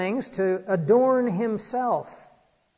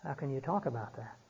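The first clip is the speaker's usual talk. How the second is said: much softer, imitating embarrassed men; hushed mock embarrassment; 3 semitones lower, 11 dB quieter than usual. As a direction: say it under your breath, as if mortified. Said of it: "they got all embarrassed".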